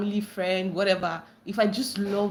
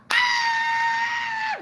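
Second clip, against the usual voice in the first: high shout then singsong; excited scream; 12 semitones higher, 4 dB louder, much slower than usual. Real, not acted. Greeting a brother who joined the live, screaming happily. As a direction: scream with joy at a friend.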